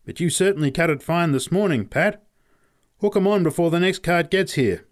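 high, loud workman's call. A narrator's reading. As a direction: call briskly down to a mate. Man calls down to driver below.

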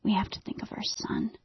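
husband urging his wife in a whisper. Retold or imitated. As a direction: whisper it, firm and scared. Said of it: "and he says".

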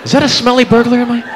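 high, rising panic; mock alarm. Guy woken by his fart, high mock panic.

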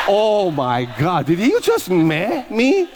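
slow, high, drawn-out mock indignation; retelling the eye roll with over-the-top disbelief; mock outrage. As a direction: ham up being personally offended. Mocking a man's eye roll.

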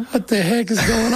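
high, startled outburst at the stranger's words; freaking out. Retold or imitated. Teen meets the wrestler's gospel talk, freaking out. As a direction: blurt it out, pitch jumping up in panic. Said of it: "like, freaking out".

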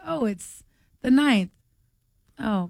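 ordinary talk, nothing marked

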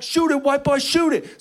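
high, loud urging yell; urging shout. Retold or imitated. Teammate yells at the kid to shoot, high. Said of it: "He's like".

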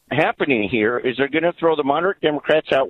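normal talking voice

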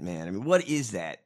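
ordinary delivery

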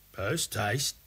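softly echoed question, rising at the end; hushed, questioning captain; puzzled thought. A narrator's reading. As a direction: think it aloud to yourself, softly. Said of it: "thought Salty Pete".

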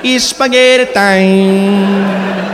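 put-on Italian accent catchphrase crooned as a lullaby; dreamy Italian accent. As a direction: lull yourself to sleep in an Italian accent. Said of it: "Say that catchphrase to yourself".

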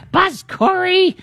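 high, slow cackling witch voice. Pretend. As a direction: squeak it out like the Wicked Witch. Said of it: "That sounds like the Wicked Witch of the West".

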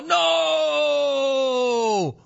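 long, high, hammed-up cry of despair; mock anguished wail. Acts player's horror at dropped ball.

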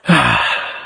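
low, resigned put-on sigh; a lazy hammed-up sigh; mock sigh. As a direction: sigh as the bored lion. Voicing the lion.

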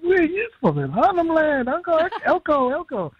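high-pitched mock call-and-answer; excited exchange. Meeting mob from home in Canada, excited exchange. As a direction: imitate excited youngsters calling to an uncle.

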